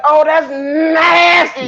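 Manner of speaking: high, slow, wailing cry of disgust; disgusted shout. Retold or imitated. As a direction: stretch out nasty like it hurts to say. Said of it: "He's like".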